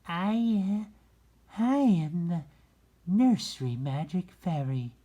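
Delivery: high, loud, bright fairy voice; high sweet fairy speaking to the rabbit. A narrator's reading. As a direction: lift it way up into a fairy's voice. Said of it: "she said".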